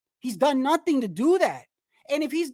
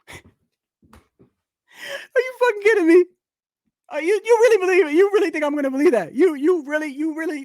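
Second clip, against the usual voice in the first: loud, higher disbelieving laugh-talk; incredulous laughter; 6 semitones higher, 8 dB louder than usual. Real, not acted. Reacting to a ridiculous claim.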